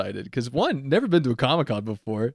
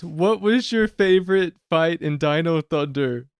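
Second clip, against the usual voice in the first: put-on higher, slow child's question; little kid; 5 semitones higher, slower than usual. Imitating a small kid asking the panel, slow higher voice. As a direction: ask it slowly like a small fan. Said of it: "He's probably about four or five".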